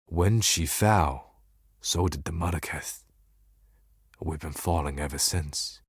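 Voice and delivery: low, hushed and subdued; softly mourning a long decline; quiet lament. Acted. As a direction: let it sink into a soft, low lament. Laments a fallen leader, people's decline.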